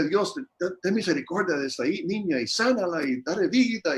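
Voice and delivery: lightly voiced pastor praying over a sick girl; pleading prayer. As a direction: beg God earnestly for the child. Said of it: "le decía".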